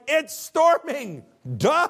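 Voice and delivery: high, loud, slow mock exasperation; sarcastic duh. Disciples answering Jesus with a high, loud sarcastic duh. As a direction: roll your eyes at the obvious. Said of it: "the disciples were like".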